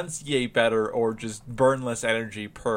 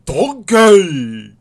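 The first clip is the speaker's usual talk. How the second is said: big, loud high Shrek-style Scottish holler; hammed-up Scottish ogre call; Scottish accent; 5 semitones higher, 13 dB louder than usual. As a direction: belt it out in a bad Scottish brogue. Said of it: "bunch of stuff on Scottish accents".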